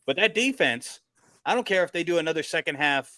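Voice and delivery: ordinary talk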